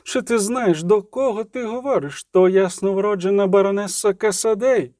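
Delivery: high, haughty outrage; indignant, high-pitched reproach; choking indignation. A narrator's reading. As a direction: protest as a scandalised matron. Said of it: "аж захлинулася Матрона".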